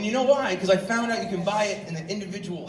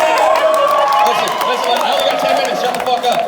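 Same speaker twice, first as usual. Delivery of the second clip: loud, much higher comic yell; mock telling off; 5 semitones higher, 9 dB louder than usual. Comedian yelling at the audience, mock angry. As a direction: bark at the crowd to shut up.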